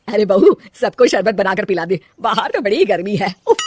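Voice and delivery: a hint of an older woman giving orders; bossy mother-in-law. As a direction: play the demanding saas calling for sherbet.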